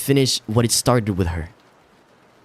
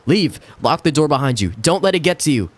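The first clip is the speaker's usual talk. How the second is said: panicked yelling; 8 semitones higher than usual. Acted. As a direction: scream at him to flee. Panicked warning to husband.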